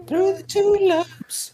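very high warbling falsetto. Retold or imitated. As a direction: croon it slow in a thin falsetto. Imitating [name] song.

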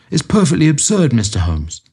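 a hint of a peevish old miser; Amberley. A narrator's reading. Client protests to Holmes.

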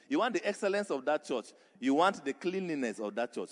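pounding repeated preacher cadence; preaching at full force. Acted. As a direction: build the sermon to a driving peak.

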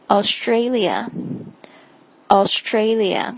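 Australia drawn out slowly, each syllable clear; slow model word. Shown as a demonstration. Teacher modelling Australia, very slow. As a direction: stretch Australia out for learners. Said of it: "接下来是澳大利亚".

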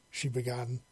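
plain reading voice

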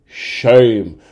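loud, falling mock chant; hammed-up public shaming call; imitated crowd yell. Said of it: "everyone just yells".

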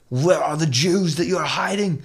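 slow, mock interrogation; Gestapo interrogator. Gestapo questioning the sisters, slow demand. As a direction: demand it like an officer searching a house. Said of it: "they came in and they're like".

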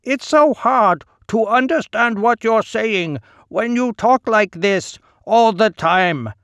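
high mock imitation of a man; mocking mimicry. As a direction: mimic him to ridicule him. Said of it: "mimicked my voice".

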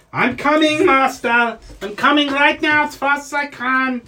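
high, eager gnome servant; gnomey voice. Acted. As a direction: squeak back obediently as a hurried little gnome. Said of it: "your little gnomey voice".

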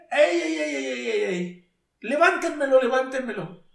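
high, loud imitation of a man calling out orders; imitated preacher yelling orders; retold commanding shout. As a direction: call out urgently over a crowd, loud and high.

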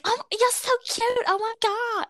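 high-pitched, cooing mock adoration; gushing American fan. Americans fawning over a British accent, gushing. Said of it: "they're like".

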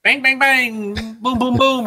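high robot battle chant; Claptrap robot voice. Retold or imitated. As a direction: bounce through the bangs like a gleeful robot. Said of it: "it's something like".

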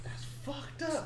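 high mock disbelief. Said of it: "I was like".

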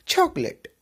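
high, insistent चॉकलेट; emphatic correct chocolate. Shown as a demonstration. Teacher stresses correct चॉकलेट, high. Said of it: "लेट करके बोलिएगा".

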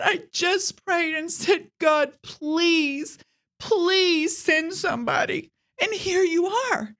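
high, slow, pleading voice; a grateful stranded lady near tears; teary old woman. Retold or imitated. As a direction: quote her prayer with a catch in the voice. Stranded lady quoting her prayer.

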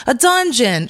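high-pitched retold reveal; high playful reveal. Guest re-enacting telling mother where she works, high reveal.

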